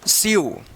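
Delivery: स्यू alone as "syoo", falling; model reading of sew. Shown as a demonstration. Teacher gives another example for learners, स्यू falling. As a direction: read स्यू as a separate model word. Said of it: "यू उच्चारण होगा".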